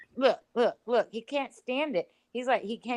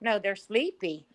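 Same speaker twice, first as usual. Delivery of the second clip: ordinary talk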